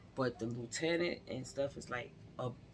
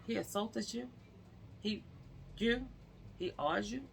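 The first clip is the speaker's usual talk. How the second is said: higher, stunned disbelief; incredulous officer; 8 semitones higher, 3 dB quieter than usual. Retold or imitated. Officer realising what happened, high and incredulous. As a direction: echo it back like you can't believe it.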